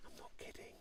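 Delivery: very soft, voiceless whisper; whispered aside. Real, not acted. Quiz host to players about the musician's late rehearsal, whispered aside. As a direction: whisper it confidingly.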